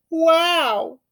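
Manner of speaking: put-on high, breathless little-girl wow; a child struck speechless; Lily's amazed wow. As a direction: gasp it out in pure wonder. Lily amazed again.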